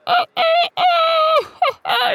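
high crowing rooster laugh; cock-a-doodle laugh. A narrator's reading. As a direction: cackle like a rooster at his own joke. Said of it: "cock-a-doodle-doodling laugh".

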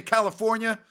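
usual speaking voice

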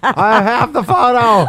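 put-on excited, laughing kid voice; excited kid brag through laughter.

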